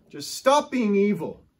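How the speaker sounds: a mentor's loud, firm rebuke; stern rebuke. Retold or imitated. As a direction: scold firmly, dragging it out. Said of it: "That's the way he would rebuke you".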